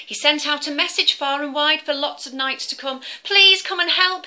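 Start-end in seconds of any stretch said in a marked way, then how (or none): 3.2-4.2 high, loud desperate plea; pleading king. Acted. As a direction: beg for help as a frantic king.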